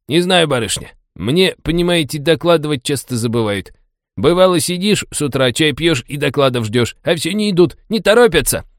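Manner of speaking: high, mock-servile cabbie banter; clowning sarcasm. A narrator's reading. Taxi driver jokes to a lady about missing reports, clowning. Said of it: "заёрничал таксист".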